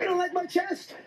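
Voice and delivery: high, mock-hurt tease; mock offended. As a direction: pretend to be wounded. Mock-hurt teasing question.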